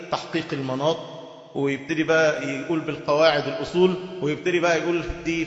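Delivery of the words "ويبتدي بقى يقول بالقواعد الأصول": ordinary talk, nothing marked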